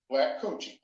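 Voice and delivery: ordinary talk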